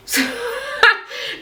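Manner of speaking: shrieking laugh up high; high squealing laugh. Real, not acted. Vlogger cracking up at the inner cry line, high squealing laugh.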